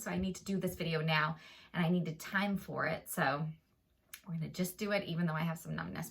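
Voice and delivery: usual speaking voice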